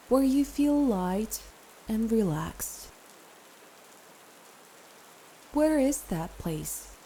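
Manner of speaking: calm meditation guide with long pauses; guided meditation. Acted. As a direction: ease the listener into rest, phrase by phrase.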